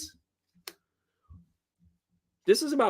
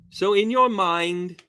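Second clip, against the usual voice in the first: ordinary delivery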